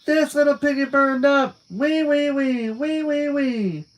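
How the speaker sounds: high, loud squealing chant; manic yelling. Acted. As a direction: squeal it like a crazed pig. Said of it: "She just kept yelling".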